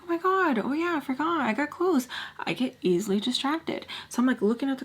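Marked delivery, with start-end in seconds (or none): 0.0-2.1 higher, lightly acted excitement; pleased, high-pitched little discovery; mild delighted surprise. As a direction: perk up as if suddenly distracted by a find. Acting out delight at finding clothes.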